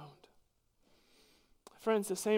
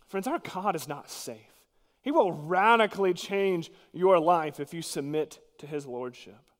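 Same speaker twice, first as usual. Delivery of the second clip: ordinary talk, nothing marked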